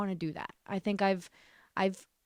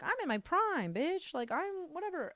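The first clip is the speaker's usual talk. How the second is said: high, softer self-quote; mock bravado; 10 semitones higher, 4 dB quieter than usual. Quoting herself at twenty-five, mock bravado. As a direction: imitate your cocky self, pitch way up.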